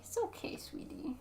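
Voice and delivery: hushed whisper to a scared cat; a soft soothing whisper to a pet. Real, not acted. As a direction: breathe the words softly to comfort him. Consoling the cat.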